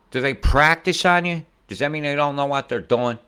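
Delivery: high loud indignant questions; outraged. Real, not acted. Ranting about hospitals.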